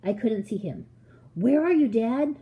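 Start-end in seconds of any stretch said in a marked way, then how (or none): 1.4-2.4 higher, louder searching question; raised, questioning call. A narrator's reading. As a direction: call out for someone you can't see. Child calling for unseen father.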